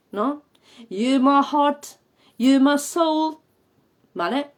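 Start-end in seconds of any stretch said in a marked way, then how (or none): 0.9-3.4 slow and higher, "heart" with an open back A; pija A. Shown as a demonstration. Teacher giving a lyric as example, "heart" stretched far back. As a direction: declaim it slowly, stretching "heart". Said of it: "por ejemplo".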